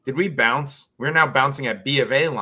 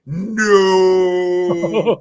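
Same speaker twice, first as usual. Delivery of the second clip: loud, high, drawn-out protest; imitated wail of objection, held long; 7 semitones higher, 9 dB louder than usual. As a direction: cry out a stretched NO from the background. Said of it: "Chill's in the background saying".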